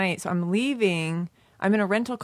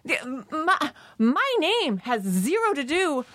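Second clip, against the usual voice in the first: high, stumbling, drawn-out retort; flustered, disarmed; 6 semitones higher, much slower than usual. Retold or imitated. Retelling giving the driver her name, flustered and disarmed. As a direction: start indignant and stumble over the words. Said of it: "I got all disarmed".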